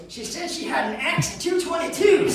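high, loud incredulous punchline; hammed-up alarmed explanation; mock panicked burglar. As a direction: protest it, laughter breaking in.

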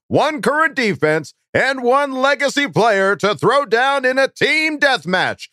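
high, slow ringside hype voice; wrestling announcer. Acted. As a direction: announce it like a title fight. Announcer-style reading of question.

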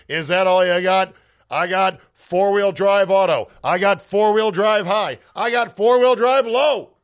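loud, high, triumphant bragging; cocky taunt. Acted. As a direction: crow at the snow like a proud truck owner. Taunting the snow with truck.

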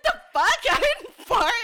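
squeaky outraged denial; stepmom's denial. Retold or imitated. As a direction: stepmom denying the fart, high indignant voice. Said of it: "And she's like".

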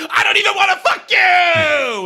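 high, slow hammed-up yell; shout. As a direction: scream it from the car trunk. Hannah retold jumping out.

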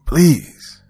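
high, loud pleading cry; begging. A narrator's reading. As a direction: burst out in an acted plea. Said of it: "she begged".

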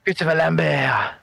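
put-on light French accent on the name. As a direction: say it as a Frenchman would. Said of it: "the secret to speaking French".

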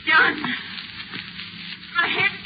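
wife begs her husband for help, growing terrified; rising panic. Acted. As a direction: call for help as fear takes hold. Wife feels herself changing.

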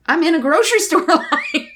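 a disbelieving chuckle at where this happened; laughing. Real, not acted. Laughs at absurd setting.

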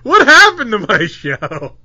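loud, drawn-out mock dismay; loud comic lament; mock outrage. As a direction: cry out in exaggerated disbelief, stretching the words. Host's mock outrage at the show.

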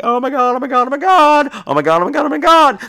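mock-panicked rapid chant; acted panic. Patient waiting in the doctor's office, mock panic.

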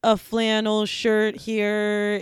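very slow auction call; slow auctioneer. Shown as a demonstration. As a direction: drag each word out slowly. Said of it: "say those exact words but slower".

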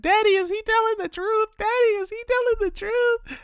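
put-on high little kid voice, pleading; squeaky kid asking his dad; child voice. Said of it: "Dominic's like".

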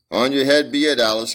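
a mother crying out at her daughter. A narrator's reading. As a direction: hurl it at her daughter in despair. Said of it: "cried her mother".

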